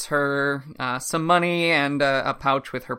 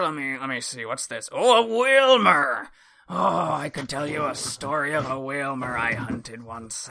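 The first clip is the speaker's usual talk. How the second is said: put-on old sailor voice. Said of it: "old sailor guy ... says".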